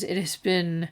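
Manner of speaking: usual speaking voice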